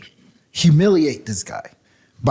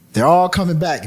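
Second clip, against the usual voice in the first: usual speaking voice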